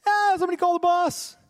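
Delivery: high-pitched mock cry for help; hammed-up frantic call upward; panicked worker. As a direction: wail it like an overwhelmed employee. Acted panicked worker calls for boss.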